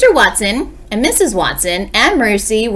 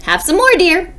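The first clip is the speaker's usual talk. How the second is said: high, lightly voiced wife; sleep-talking wife; 10 semitones higher, 3 dB louder than usual. A narrator's reading. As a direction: offer it in a high storybook voice. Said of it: "Missus Watson said".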